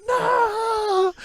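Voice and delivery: high piercing mock scream of no; mom's anguished cry. Mother screaming as her daughter drops into lava. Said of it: "and the mom goes".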